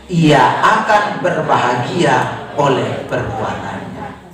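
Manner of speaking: slow, weighty verse reading; scripture recitation. Acted. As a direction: draw out each word for the congregation. Leads the repeated verse.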